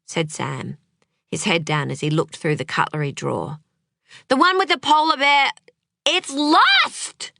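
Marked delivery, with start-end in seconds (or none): 4.3-7.3 high, loud frustrated shout. Acted. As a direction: yell at the husband over the lost scoop. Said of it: "shouted Clementine".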